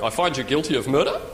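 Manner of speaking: judge's verdict rising into a question; solemn sentence with questioning lift on "murder"; uptalk verdict. Acted. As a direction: swing "murder" up as if unsure. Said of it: "we all go up at the end".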